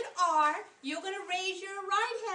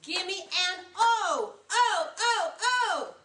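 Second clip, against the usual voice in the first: cheerleader; 3 dB louder, slower than usual. Shown as a demonstration. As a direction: shout it out like a pep squad. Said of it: "I wanna hear those cheerleader voices".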